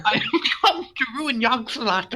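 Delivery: laughing villain taunt; high, cackling villain proclamation; unhinged villain. Pretend. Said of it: "I'm gonna do an evil laugh".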